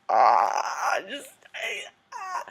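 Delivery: loud gush breaking into high squeaks; squeal, lost for words; swooning, high-pitched fangirl noises; overwhelmed fangirl squeal. Real, not acted. Gushing over the actor's arrival.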